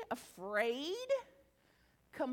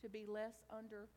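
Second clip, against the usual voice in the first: guided meditation; 7 semitones lower, 16 dB quieter than usual. Real, not acted. Speaker guiding the audience into reflection, soft meditation voice. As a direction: ease them gently inward.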